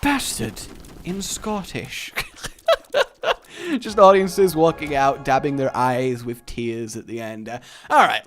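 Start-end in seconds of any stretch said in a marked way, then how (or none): 0.0-2.1 slow, higher mock trailer title; pretentious film title. Announcer naming a pretentious film, mock-grand. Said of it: "sounds like a really pretentious film". 2.2-3.7 high, giggling cackle; high laugh. Real, not acted. Player amused by his own film joke, laughing.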